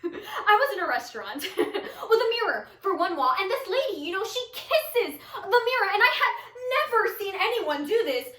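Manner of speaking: high, loud storytelling broken by laughter; giddy, unhinged monologue character. Acted. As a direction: crack up as you tell it.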